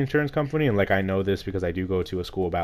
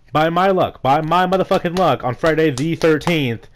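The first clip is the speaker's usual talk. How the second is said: louder, higher, dragging out the bad luck; exasperated outburst; 7 semitones higher, 5 dB louder than usual. Real, not acted. Telling viewers about the cop, exasperated and loud. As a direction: rant at the unfair luck.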